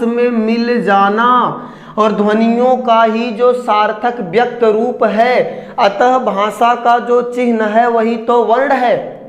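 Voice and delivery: slow explaining. Shown as a demonstration. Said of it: "यहां देखिए मैंने लिखा है".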